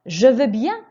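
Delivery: teacher models eager agreement; keen. Shown as a demonstration. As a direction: accept gladly, with energy. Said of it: "Écoutez et répétez".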